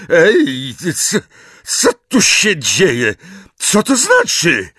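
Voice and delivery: high, loud, halting questions; stammer, then demand what is going on; drawn-out, high-pitched confusion; bewildered demand. A narrator's reading. Confused wizard demands explanation.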